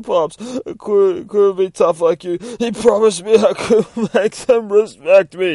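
high, loud wailing cry to his dead father; sobbing son begging his pops; sobbing plea. Acted. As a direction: wail it through tears. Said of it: "He's crying on the ground".

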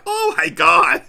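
very high, loud outburst breaking into laughter; retold shock. Fan reliving Voldemort's return for a friend, loudly stunned. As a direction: blurt it out, thrilled and stunned. Retells reaction to the scene.

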